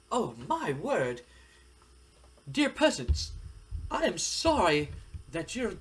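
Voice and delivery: high-pitched, hammed-up aristocrat talking down; haughty snob. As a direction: play a snooty prince pretending to apologize to peasants. Haughty wrestler addresses his former partners.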